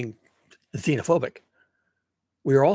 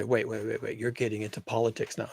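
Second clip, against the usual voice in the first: objecting listener; 5 semitones lower, 9 dB quieter than usual. Retold or imitated. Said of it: "You can say".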